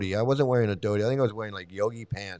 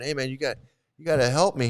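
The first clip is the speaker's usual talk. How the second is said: mild urgent plea, slightly raised; retold freak-out; 4 semitones higher, 3 dB louder, faster than usual. Stuck in mud calling to a friend, mildly panicked. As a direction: re-enact a worried call for help. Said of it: "and I'm freaking out".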